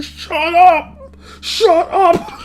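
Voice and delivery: very high, shrieked "Shut up!"; mock furious yell. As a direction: play the annoyed friend snapping. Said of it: "the guy was so mad".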